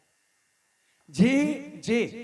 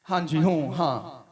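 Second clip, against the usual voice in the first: lower, lazy mock agreement; lower, offhand imitation of a congregant dodging repentance; mock brush-off; 7 semitones lower than usual. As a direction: imitate someone shrugging off the preacher. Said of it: "ਤੁਸੀਂ ਹੂੰ, ਹਾਂ ਕਰਕੇ ... ਟਾਲ ਰਹੇ".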